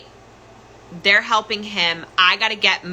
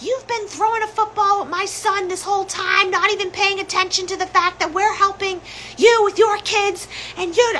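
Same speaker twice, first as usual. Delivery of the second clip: much higher, mimicking voice of the nagging sister; mocking sister; 12 semitones higher than usual. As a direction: parody her whiny complaint, pitched way up. Sister's complaint voiced in story.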